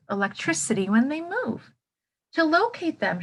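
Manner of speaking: ordinary talk, nothing marked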